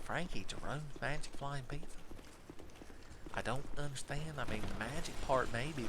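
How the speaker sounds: soft, bewildered, stumbling sub; puzzled teacher. Acted. Teacher puzzling over the pizza name, soft and baffled. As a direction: fumble through it confused and hushed.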